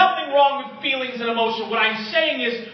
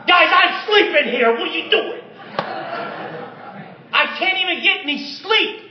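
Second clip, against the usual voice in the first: grumpy woken Jesus; 7 semitones higher than usual. Retold or imitated. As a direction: lightly play someone woken up, annoyed. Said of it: "He was really upset".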